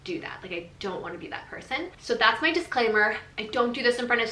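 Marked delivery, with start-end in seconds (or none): 0.0-1.9 soft, half-whispered giving in; hushed agreement. Retold or imitated. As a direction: concede quietly so the baby won't hear. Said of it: "And I'm like".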